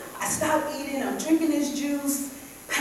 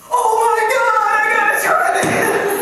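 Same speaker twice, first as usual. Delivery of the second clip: higher and louder, sudden thrill; excited outburst; 10 semitones higher, 11 dB louder than usual. Retold or imitated. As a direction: swept up by the movie, ready to start now. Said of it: "I'm like".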